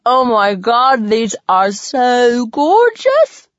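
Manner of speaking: talking through a mouthful; words round sticky caramel; mouth full. A narrator's reading. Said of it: "through a mouthful of caramel gunk".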